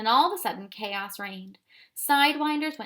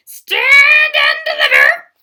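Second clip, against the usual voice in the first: screeching, far higher and louder; top-of-voice shriek; 20 dB louder than usual. A narrator's reading. Wiffle bird yelling at the army, a full shriek. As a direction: shriek it with everything she has. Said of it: "shrieked at the top of her voice".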